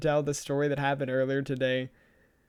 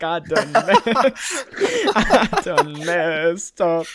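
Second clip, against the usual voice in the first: laughing through the words, slowing down; 6 dB louder, much slower than usual. Retold or imitated. Boyfriend admits through laughter that he messed up, drawn out. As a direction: giggle it out, drawn long. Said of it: "I was like".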